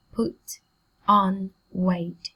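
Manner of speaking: "put on weight" with a pause after each word; careful, spaced-out "put on weight". Shown as a demonstration. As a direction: separate PUT, ON, WEIGHT into three beats. Teacher separates the words.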